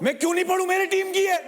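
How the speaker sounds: high, indignant shout; angry retort. Real, not acted. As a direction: fire back in defence of a teammate. Angrily defending a teammate.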